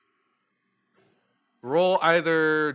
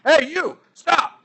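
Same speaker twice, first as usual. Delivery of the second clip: loud, high guard's shout; guard yelling; 12 semitones higher, 7 dB louder than usual. Acted. Guard yelling at the thief to stop, loud and high. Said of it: "The guard's like".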